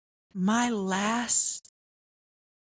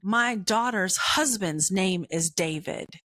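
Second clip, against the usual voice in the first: normal talking voice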